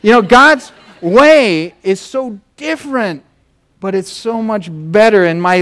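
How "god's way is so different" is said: high, very slow and drawn out; emphatic preaching. Real, not acted. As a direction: lean hard on each word. Preacher's climactic point.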